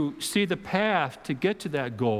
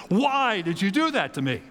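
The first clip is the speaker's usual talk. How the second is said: mock anger; 5 semitones higher than usual. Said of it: "There's this surge of anger".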